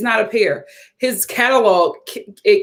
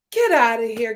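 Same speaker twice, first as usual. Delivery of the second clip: ordinary talk, nothing marked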